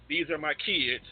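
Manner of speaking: ordinary delivery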